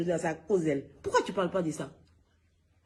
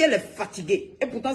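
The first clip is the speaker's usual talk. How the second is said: ordinary talk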